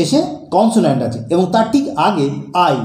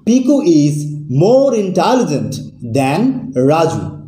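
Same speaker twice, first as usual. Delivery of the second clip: slow example reading; much slower than usual. Shown as a demonstration. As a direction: dictate the example slowly and clearly. Teacher reads example sentence slowly. (ধীরে ধীরে উদাহরণ বাক্য বলা)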